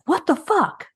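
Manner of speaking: high, squawking mock outrage; outraged posters. Mimicking angry Facebook posts, high mock outrage. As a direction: exclaim it like a string of fed-up posts.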